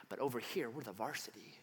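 low, very soft, self-important aside; hushed superiority. Retold or imitated. Varsity Christians boasting over JV, hushed superiority. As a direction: drop almost to a whisper.

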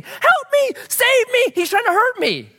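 high, loud mock shriek for help; imitated shriek. Pastor acting the woman shrieking at coffee stand, imitated panic. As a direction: imitate a panicked cry for rescue.

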